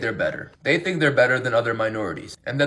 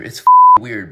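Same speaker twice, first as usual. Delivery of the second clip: sudden loud outburst; 12 dB louder than usual. Real, not acted.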